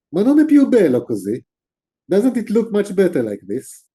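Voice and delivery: affronted, as if the answer were obvious; mock-offended Italian farmer insisting on beauty; almost insulted. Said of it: "almost insulted".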